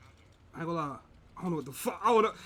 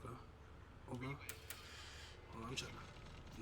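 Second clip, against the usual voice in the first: whispering; 10 semitones lower, 24 dB quieter, slower than usual. Real, not acted. Hiding from killer, whispering.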